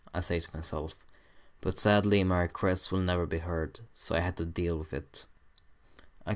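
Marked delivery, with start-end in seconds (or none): none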